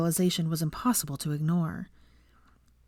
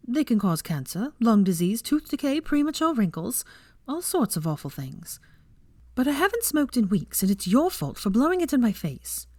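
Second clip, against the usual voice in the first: put-on a much higher young woman's voice, listing dangers; higher-voiced heroine lecturing, then sheepish; Hermione, lecturing; 6 semitones higher than usual. A narrator's reading. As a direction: rattle off the risks in a high, prim voice.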